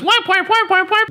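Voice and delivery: high squeaky whap whap noises; high sound effect. Retold or imitated. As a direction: squeak out the whaps. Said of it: "his punchline was".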